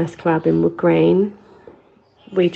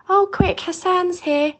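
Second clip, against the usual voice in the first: much higher, lightly voiced nan hurrying; nan calling out; 13 semitones higher than usual. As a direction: pitch it up like a busy nan at the stove. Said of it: "and go".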